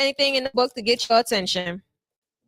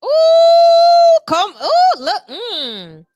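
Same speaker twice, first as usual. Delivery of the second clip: loud, very high delighted ooh with a laugh; excited squeal; 12 semitones higher, 9 dB louder, much slower than usual. Real, not acted. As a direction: shriek with joy at the card. Excited reaction to cards.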